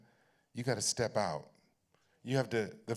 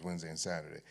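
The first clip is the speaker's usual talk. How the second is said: usual speaking voice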